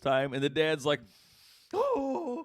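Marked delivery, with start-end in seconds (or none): 1.7-2.4 high-pitched drawn-out mock wail; mental breakdown. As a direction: wail like a dad falling apart. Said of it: "having a fucking mental breakdown".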